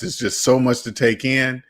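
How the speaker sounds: usual speaking voice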